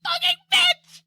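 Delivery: high, screeched mock rage; retold angry yell, far up; mock furious outburst. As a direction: shriek it at the villain. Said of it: "I was like".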